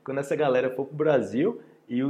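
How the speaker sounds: ordinary delivery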